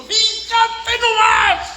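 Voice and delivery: high, drawn-out exclamation; preacher's long high shout; excited preaching cry. Real, not acted. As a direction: cry it out high and slow to the congregation.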